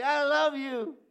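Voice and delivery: high, loud excited "I love you"; gushing "I love you" with emphasis on love. Shown as a demonstration. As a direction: burst out with it, thrilled. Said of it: "you get excited when you tell somebody".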